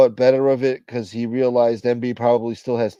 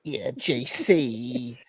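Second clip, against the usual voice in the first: creepy slow voice; much slower than usual. Pretend. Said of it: "These aren't men".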